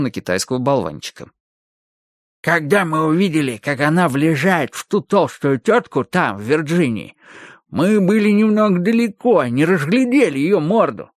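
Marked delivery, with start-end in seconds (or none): 2.4-11.1 high, whining excuse-maker voice; squeaky skinny henchman. A narrator's reading. Skinny henchman explains the mistake to his boss, whining. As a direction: plead your case in a high put-on voice.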